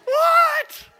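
very high, loud shocked squeal; stunned disbelief. Retold or imitated. Disbelief at free phone.

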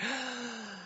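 a soft, breathy mock gasp; hammed-up seductive gasp. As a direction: gasp like a temptress. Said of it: "this is my interpretation".

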